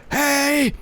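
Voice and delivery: a terrible stab at the actor's voice; Michael Rooker impression. Friend attempting the actor's voice, hammed-up and high. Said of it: "No, that's terrible".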